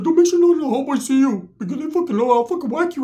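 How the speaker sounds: high squeaky threat; Ricky's high-pitched voice. Retold or imitated. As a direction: threaten in a comic falsetto. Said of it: "He has that high-pitched voice".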